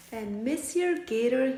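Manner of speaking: ordinary delivery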